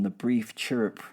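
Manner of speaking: plain reading voice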